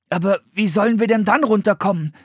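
put-on high child's voice; high, anxious question; questioning boy. A narrator's reading. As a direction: ask with puzzled urgency. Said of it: "fragte Philipp".

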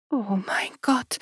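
hushed, frightened whisper. A narrator's reading. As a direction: breathe it in dread. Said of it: "hörte er seine Frau flüstern". (ängstliches Flüstern)

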